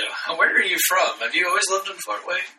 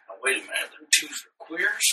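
put-on deep Southern accent; 7 dB quieter than usual. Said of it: "we know a guy who's got a deep accent like that".

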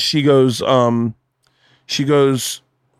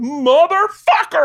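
high, stretched-out swearword; hammed-up outburst, drawn out slowly; mock cursing; much slower than usual. Husband supposedly cursing out of nowhere, mock yell.

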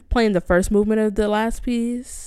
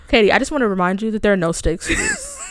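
laughing through the words; 6 semitones higher than usual. Real, not acted. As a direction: say it while cracking up. Teasing her over the stakes.

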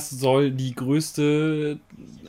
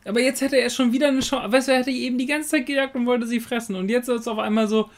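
excited; 8 semitones higher, 3 dB louder than usual. Real, not acted. Excited rant about dragon.

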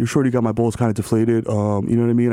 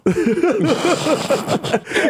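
high laugh; 11 semitones higher than usual. Acted. Freestyle bit, big laugh.

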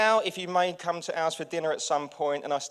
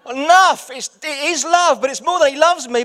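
ordinary talk

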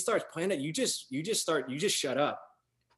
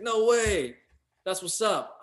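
higher, louder excited exclamation; excited reaction; 7 semitones higher, 5 dB louder, slower than usual. Retold or imitated. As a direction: imitate a guy delighted by the coincidence. Friend thrilled at shared Bay roots.

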